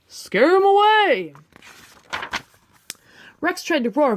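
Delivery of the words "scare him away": high, loud, drawn-out call; urgent shout. Acted. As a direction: urge him on loudly. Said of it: "said Trixie".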